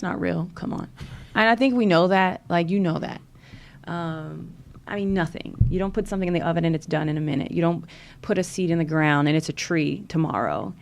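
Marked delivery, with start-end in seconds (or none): none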